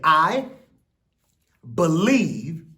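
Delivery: put-on loud, slow call for the kids to echo; a rousing chant line for young viewers; loud call to repeat. Shown as a demonstration. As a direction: say it big so they shout it back.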